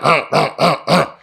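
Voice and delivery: imitated short, repeated pig grunts; boar grunts. Imitating the boar after the rock hit, repeated grunts. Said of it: "you just start hearing a grunt".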